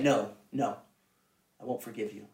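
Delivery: low, hushed acted refusal; quiet refusal. Father refusing his sorry child, quiet refusal. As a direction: drop right down into a soft, low no.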